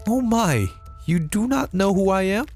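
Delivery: high surprised maid voice. Acted. Maid shocked her master forgets her, high surprised.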